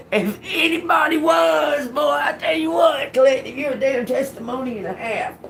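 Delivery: high, loud shout of praise; excited shout. Real, not acted. Preacher shouting praise to the listener, loud and high. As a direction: belt it out like a preacher.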